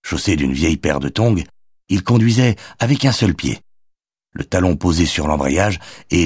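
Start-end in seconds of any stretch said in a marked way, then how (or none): none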